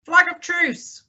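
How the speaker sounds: high-pitched hail from afar; high, carrying call; crying out. A narrator's reading. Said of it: "cried Silver".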